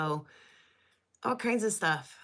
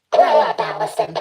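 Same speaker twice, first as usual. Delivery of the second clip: loud robot voice filter; 9 dB louder than usual. Pretend. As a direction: snap it through the robot effect. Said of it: "robot voice".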